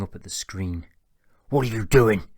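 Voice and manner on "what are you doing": loud startled shout; dad shouting in alarm. A narrator's reading. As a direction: yell it at the son. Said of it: "shouted his dad".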